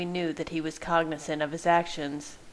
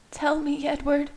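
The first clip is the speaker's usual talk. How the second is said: higher, pressing plea; desperate; 10 semitones higher than usual. Acted. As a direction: beg him desperately. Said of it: "she said desperately".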